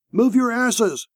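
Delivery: high, urgent yell without much volume; shouted order. A narrator's reading. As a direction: drive the squad out under fire. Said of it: "Merriweather shouted again".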